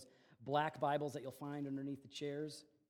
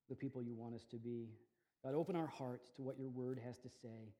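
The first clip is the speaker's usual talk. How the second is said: soft, reverent prayer voice; 4 semitones lower, 5 dB quieter than usual. Acted. Pastor praying for the congregation, soft and reverent. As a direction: pray humbly, quieter, on behalf of all.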